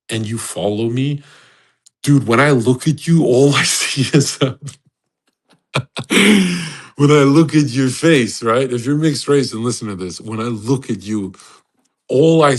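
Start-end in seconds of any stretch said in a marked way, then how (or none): 5.7-6.8 high, breaking laugh; real laugh, pitched up; burst of laughter. Real, not acted. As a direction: crack up mid-sentence. Laughs at his own joke.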